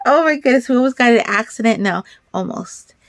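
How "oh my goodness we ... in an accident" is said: ordinary talk, nothing marked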